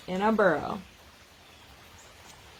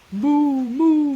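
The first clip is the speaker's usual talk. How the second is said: loud, higher mooing; cow moo; 6 semitones higher, 10 dB louder than usual. Acted. Reading the cow's part to children, loud moos.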